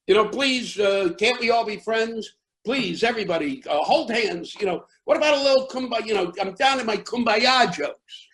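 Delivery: high, pleading mock appeal for calm; pleading peacemaker. Comic pleads with the brawling crowd, mock pleading. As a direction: beg the crowd to make peace, half-joking. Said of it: "So I said".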